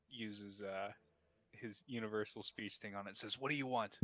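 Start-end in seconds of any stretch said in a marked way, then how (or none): 3.4-4.0 raised voice, a touch higher; yelling through the room. Acted. Anton questions the raven next door, mildly yelling. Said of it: "you're just yelling through the room".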